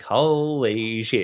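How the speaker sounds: very slow, drawn-out awe; stunned amazement. Retold or imitated. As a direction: stretch each word in retold disbelief. Retold amazement at crowd chant.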